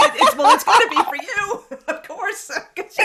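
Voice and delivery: high laughing through words; amused laughing. Real, not acted. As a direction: talk while laughing hard. Laughing along with guest.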